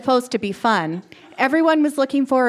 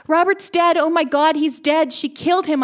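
screaming; 7 semitones higher than usual. A narrator's reading. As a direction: shriek it out in horror. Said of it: "I screamed".

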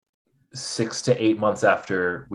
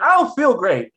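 much higher and louder, plaintive complaint; whiny self quote; 6 dB louder than usual. Retold or imitated. To the doctor, a higher, louder plaintive moan. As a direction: play her past self moaning to the doctor.

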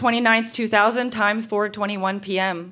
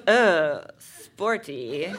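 imitated boyfriend; 8 semitones lower, much slower than usual. Boyfriend reacting to her sports bra, low mock voice.

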